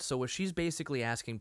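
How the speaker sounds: usual speaking voice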